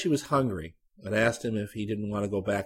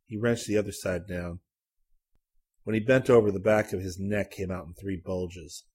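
usual speaking voice

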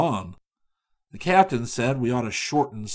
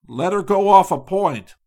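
loud, raised command with OFF stressed; captain's order; 7 semitones higher, 9 dB louder than usual. Acted. Captain orders the steersman in the gale, loud command. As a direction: bark it across the deck.

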